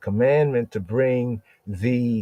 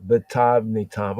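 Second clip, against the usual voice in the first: ordinary delivery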